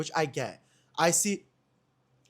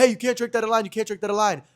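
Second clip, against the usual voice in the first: higher, louder telling-off voice; scolding security guard; 8 semitones higher, 7 dB louder, faster than usual. Retold or imitated. Security guard to drinker in the line, scolding. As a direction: call out a rule like a club guard. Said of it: "the security guard was like".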